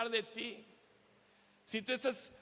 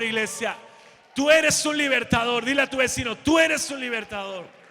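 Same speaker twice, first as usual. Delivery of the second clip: loud, rising pulpit proclamation, slowed on key words; full-force preaching; 8 dB louder than usual. Acted. Preacher proclaiming to the church, at full force. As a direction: shout the calling over the congregation.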